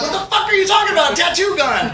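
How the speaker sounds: high, fast shout of disbelief; baffled teen yelling back; shocked disbelief. Retold or imitated. Teen baffled by the tattoo gun, shouting in disbelief.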